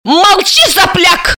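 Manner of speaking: high shrill yelp of fury; shrieking. Acted. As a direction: shriek at the boy. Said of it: "взвизгнула Бессарабова".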